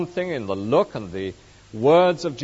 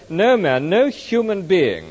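"man" and "human being" punched, louder; emphatic "no man", then "no human being" driven home; stress on MAN; 5 semitones higher, 5 dB louder, slower than usual. Shown as a demonstration. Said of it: "the emphasis is on the word".

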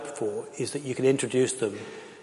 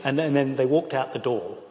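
ordinary talk

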